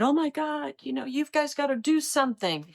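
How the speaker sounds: high, frantic caller voice; a prank caller faking alarm; mock panic from the caller; panicked caller. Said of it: "this panicked driver called me and said".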